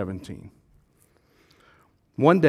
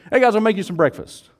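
loud, higher, casual call to the guys; Jesus calling out; 7 semitones higher, 7 dB louder than usual. Acted. Jesus offers the disciples breakfast, calling out casually. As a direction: holler a breakfast offer to your buddies.

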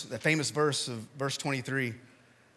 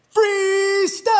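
high, loud hype-man yell; a camp MC yelling to teenagers, very high and loud; 11 dB louder than usual. Retold or imitated. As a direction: belt it out to a roaring crowd. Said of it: "he would just yell these two words".

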